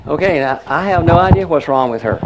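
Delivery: ordinary delivery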